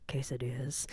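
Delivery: hushed, low whisper; furtive whisper. Retold or imitated. Whispering a food order to Dad during the breakup, furtive. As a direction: whisper it as if hiding the call.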